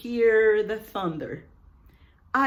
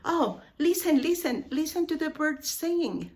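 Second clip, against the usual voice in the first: higher, fast and eager; eager call to listen; 7 semitones higher, faster than usual. Shown as a demonstration. Teacher playing a friend urging birdsong. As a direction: urge them to hear the birds. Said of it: "What if I tell you".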